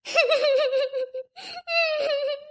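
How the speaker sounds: high sobbing breath breaking out; sob. Acted. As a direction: let the sob burst before she speaks. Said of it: "ಅಳ್ಬೇಡ". (ಅಳು ಒತ್ತಿ ಬರುವ ಬಿಕ್ಕಳಿಕೆ)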